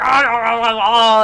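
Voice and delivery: loud jumbled brawling noise; imitated squabbling "ah-ah-ah"; mock scuffling yells.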